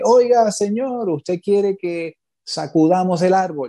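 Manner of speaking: high, slow imitated call; retold yell. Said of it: "me grita y me dice".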